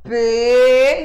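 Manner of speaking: P held as a loud closed PÊ; closed E sound. Shown as a demonstration. Teacher repeating the closed sound for pupils, long loud PÊ for P. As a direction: draw "P" out loudly as "pê", vowel closed. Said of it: "é um som fechado".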